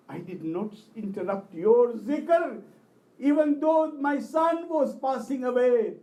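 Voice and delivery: loud, slow, emphatic appeal; impassioned plea. Retold or imitated. Bereaved reciter pleading to Abbas at the shrine, impassioned loud plea.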